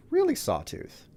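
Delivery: can't believe it, pitch jumps up; incredulous. Real, not acted. Player reacting to the Sawtooth attacking him, incredulous.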